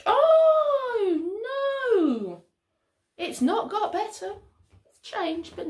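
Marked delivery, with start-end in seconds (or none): 0.1-2.4 high wail sliding down; dramatic dismay. Pretend. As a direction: stretch out a big storyteller's "oh no". Narrator dismayed at tantrum.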